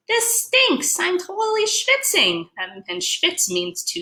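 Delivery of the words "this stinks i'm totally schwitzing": put-on much higher, whiny kid voice; complaining fish brother. Fish brothers complaining to each other, high whine. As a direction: whine about the heat. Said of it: "The brothers are saying".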